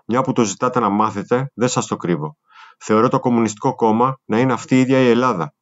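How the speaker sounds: usual speaking voice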